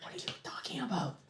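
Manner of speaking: whispered, puzzled query; hushed question. Acted. Questioning a companion in hiding, urgent whisper.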